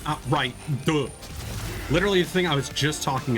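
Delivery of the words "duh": usual speaking voice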